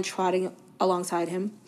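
ordinary delivery, nothing marked

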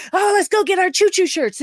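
high, squealing mock excitement; super excited. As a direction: bounce with glee over the shirts. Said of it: "Boimler's super excited".

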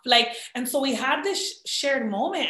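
ordinary talk

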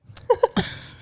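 airy unvoiced high laugh; high breathy giggle. Real, not acted. Laughs at own brazen story.

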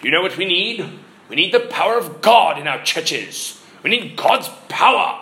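imitating a Welsh preacher; earnest Welsh-accented pulpit plea. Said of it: "in his Welsh accent".

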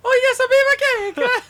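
very high, loud squealing catchphrase; mock wrestler yelp. Podcaster imitating a wrestler, high squealing mock yell. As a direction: screech it like a hammy wrestler.

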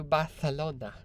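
Barcelona with a lisped c, Barthelona. Shown as a demonstration. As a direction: lisp the c in Barcelona like th. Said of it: "You gotta say it with a lisp".

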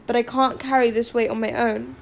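ordinary delivery, nothing marked

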